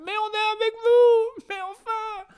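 high mock wail; whining offended liberal. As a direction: whine about the savages in shock. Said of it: "et il fait".

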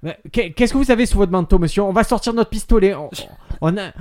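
loud, high, urgent police orders; policier menaçant. Acted. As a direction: escalate into an acted armed warning. Policier joué menace de tirer. (policier qui hausse le ton, voix forte)

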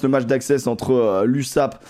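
ordinary delivery

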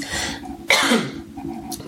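dry cough into the mic; real cough. Real, not acted. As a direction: cough without covering the mic. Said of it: "Pardon, je tousse". (toux sèche)

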